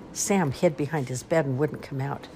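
plain reading voice

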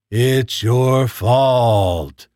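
very slow, drawn-out robot voice; terrifying robot monotone; robotic voice. Acted. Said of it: "he said in a terrifying robotic voice".

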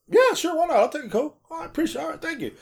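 light, high-pitched voice accepting a favor; imitating an easygoing, grateful rider; retold polite acceptance. Acts out grateful rider reply.

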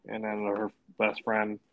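usual speaking voice

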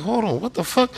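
baffled, high-pitched double take; retold disbelief. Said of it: "I'm like".

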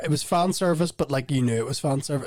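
ordinary talk, nothing marked

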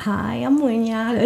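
put-on slow, easy English in a smooth American accent; fluent English demo. Recalling New York events, she demos her fluent American accent. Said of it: "Tu vois, mon accent qui est blabla".

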